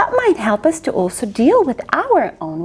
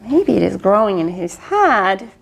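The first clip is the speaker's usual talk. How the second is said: ordinary delivery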